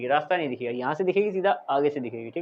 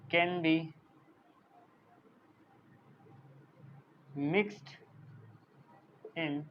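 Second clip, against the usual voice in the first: word by word, long gaps, growing softer; slow dictation; 2 semitones lower, 6 dB quieter, much slower than usual. Real, not acted. Teacher dictates the solubility definition, halting and slow. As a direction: dictate a definition as you write it out.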